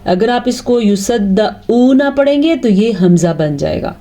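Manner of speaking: usual speaking voice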